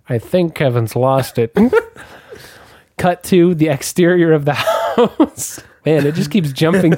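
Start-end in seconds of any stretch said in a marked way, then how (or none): none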